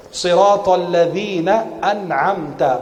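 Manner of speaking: slow, drawn-out Siratal ladzina an'amta with a clear ain; measured Qur'an teaching recital; slow model recitation. Shown as a demonstration. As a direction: recite "an'amta" carefully, stretching the long vowels.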